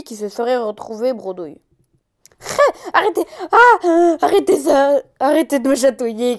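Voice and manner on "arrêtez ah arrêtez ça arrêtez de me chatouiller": being tickled. A narrator's reading. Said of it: "cria Fifi".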